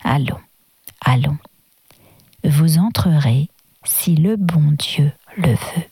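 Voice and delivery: the Pope's low, slow, measured words, each syllable weighted; low and slow, leaning on each syllable; stressing each syllable. A narrator's reading. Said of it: "en appuyant sur chaque syllabe".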